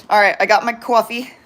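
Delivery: "coffee" in New Jersey style, "kwawfee"; thick New Jersey twang on "coffee"; New Jersey accent. Shown as a demonstration. Vlogger shows her New Jersey accent on purpose. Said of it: "I said the New Jersey accent on purpose".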